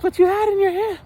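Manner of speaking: high mock falsetto; whiny squeaky impression of her; imitating his date. Date pressing him about the seasoning, in a high mock falsetto. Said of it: "She's like".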